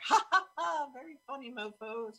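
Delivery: mock the voices with a scornful laugh; sarcastic fake laugh. Mocking the voices together.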